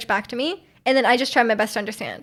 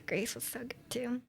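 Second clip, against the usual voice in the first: whisper; 20 dB quieter than usual. Real, not acted. Streamer whispering a quick aside to chat. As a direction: whisper "Greece was so good, too" under the breath, barely voiced.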